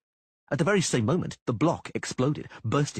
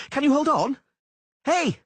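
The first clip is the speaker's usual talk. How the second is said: calling down loudly; 7 dB louder than usual. A narrator's reading. Dandelion calling down.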